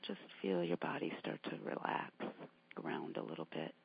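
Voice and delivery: low, breathy, barely voiced guidance; soft meditation murmur. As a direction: sink the voice right down to rest.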